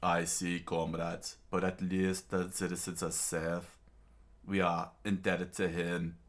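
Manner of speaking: put-on king with a Russian accent. King answers his kneeling vassals, Russian accent. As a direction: speak as a measured Russian-accented ruler. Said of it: "I'll give him a Russian voice".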